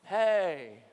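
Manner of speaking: hey drawn out low and falling; long, easy hey on a low pitch; effortless letter hey. Shown as a demonstration. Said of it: "requires no effort".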